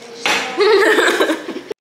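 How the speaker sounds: bright burst of laughter. Real, not acted. Laughing at friend's remark.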